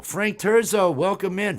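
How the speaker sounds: loud, high welcome shout; streamer shout-out. Real, not acted. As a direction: greet him like a host.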